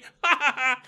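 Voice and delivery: loud, high burst of laughter; real laugh bursting out. Real, not acted. Laughs after gory image.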